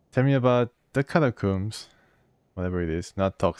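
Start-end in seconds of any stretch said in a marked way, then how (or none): 0.1-1.9 higher, louder pointed question; a lightly voiced hero demanding information; demanding questioner. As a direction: press him for answers. Player character questioning NPC.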